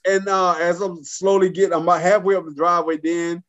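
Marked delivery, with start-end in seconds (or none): none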